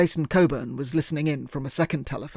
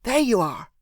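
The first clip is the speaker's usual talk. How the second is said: much higher, bright cry of a pet name; delighted exclamation; 7 semitones higher than usual. Acted. Emily in bed, exclaiming as her partner walks in. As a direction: burst out with joy at seeing him. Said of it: "she exclaimed".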